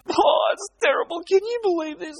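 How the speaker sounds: high, whiny mock complaint; mock whining. Preacher mocking complaining Christians, high whiny voice. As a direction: whine it like a self-pitying believer. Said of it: "they're crying and they're whinging".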